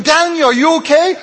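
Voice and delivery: high, loud, worried call; king at the den in a painting, high and anxious. Acted. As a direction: call down to Daniel in mock alarm. King calls in Sunday-school picture.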